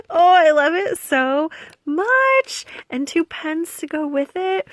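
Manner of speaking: adore the gift out loud; delighted gushing. Real, not acted.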